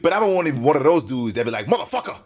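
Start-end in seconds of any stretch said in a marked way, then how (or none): 1.7-2.1 imitating hard-hitting old-timer swearing; mad hard. Reactor imitating old-school car guys, mad hard. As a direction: punch the curse out high, with a laugh. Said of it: "they say it mad hard".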